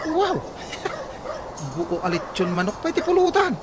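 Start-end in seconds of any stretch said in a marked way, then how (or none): 0.4-1.7 a sudden high laugh, trailing off; high amused laugh. Acted. Friend laughs hearing he bought the pork, high amused laugh.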